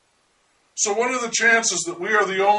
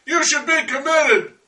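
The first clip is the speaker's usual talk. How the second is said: a higher, louder jeering yell from a stranger; retold shout; 7 semitones higher, 3 dB louder than usual. Stranger on the street shouting at him, higher and louder yell. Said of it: "he shouted".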